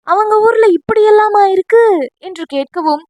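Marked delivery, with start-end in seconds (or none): 0.1-1.8 high, loud disbelieving question; amazed question. Acted. Friend amazed at the relatives' odd custom, high and loud. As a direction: sound truly astonished.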